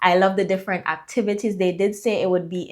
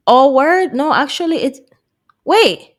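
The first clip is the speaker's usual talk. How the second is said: surprised; 7 semitones higher, 4 dB louder than usual. Real, not acted. Realizes mistake.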